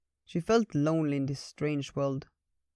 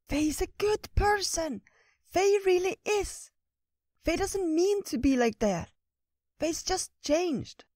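earnest squirrel; slower than usual. Acted. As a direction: confide like a little squirrel pleading for a friend. Squirrel Ratatosk confides.

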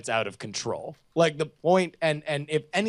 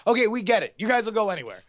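exasperated; 7 semitones higher, 3 dB louder, much faster than usual. Retold or imitated. Mocking the show's excess.

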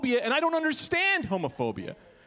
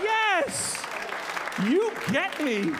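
overjoyed yes; 10 semitones higher, slower than usual. Retold or imitated. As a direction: shout yes like the dream came true. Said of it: "I am like".

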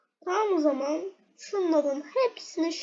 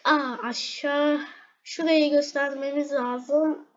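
ordinary delivery

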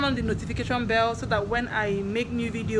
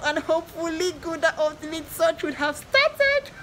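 high, rising squeal of anticipation; giddy high-pitched sign-off; excited; 7 semitones higher than usual. Real, not acted. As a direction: build to a high squeal at the end. Excited sign-off about show returning.

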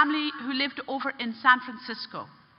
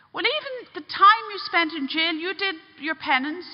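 high, emphatic urging; impassioned reassurance; earnest persuasion; 7 semitones higher, 4 dB louder than usual. Retold or imitated. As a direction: plead the case with raised voice. Priest urges forgiveness on the man.

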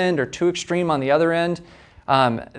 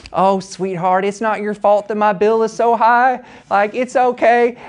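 put-on butchered Southern accent; 7 semitones higher, 5 dB louder than usual. Caller from the South soothing the phone agent, in a hammed-up Southern accent. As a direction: play a sweet Southern caller with an overdone Southern accent. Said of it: "I didn't mean to butcher that Southern accent".

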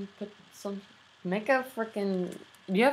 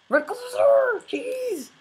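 much higher, squeaky yelp of surprise; startled yelp; 5 dB louder, much slower than usual. Real, not acted. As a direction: yelp at a sudden scare. Sudden fright in game.

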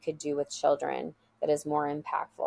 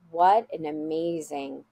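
ordinary delivery

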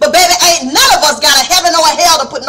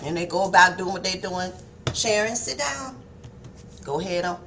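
quiet, low imitated mother's call; mother starting gently with the children; softer mama; 8 semitones lower, 15 dB quieter than usual. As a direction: say it softly, not yet firm. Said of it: "They'll start a little softer".

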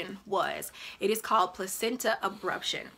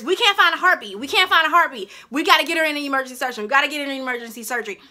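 loud, raised, hurried doctors' calls; urgent alarm; 5 semitones higher, 7 dB louder, much faster than usual. Retold or imitated. Doctors rush patient to emergency surgery, urgent alarm. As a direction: rattle off the emergency like an alarmed medical team.

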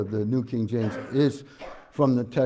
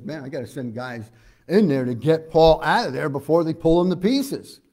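raised, louder urgent exclamation; louder, climbing higher toward the end; urgent captain; 4 semitones higher, 6 dB louder than usual. Acted. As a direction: act out a captain urging a rescue. Captain urgently ordering Paul's rescue.

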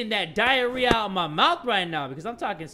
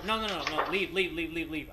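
ordinary delivery

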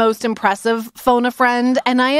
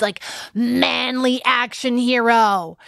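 usual speaking voice

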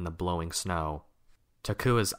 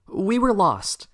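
much higher, loud call; calling after someone; 13 semitones higher, 8 dB louder, slower than usual. A narrator's reading. Takua calls after Kopaka through the snow, loudly. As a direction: call out to someone striding away. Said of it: "Takua called after Kopaka".

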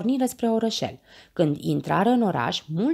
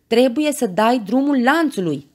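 high, loud plea mid-rescue; urgent insistence; 7 semitones higher, 6 dB louder than usual. A narrator's reading. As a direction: shout it as a pressing instruction. Rescuer urges Meda to let go. (îndemn strigat, insistent)

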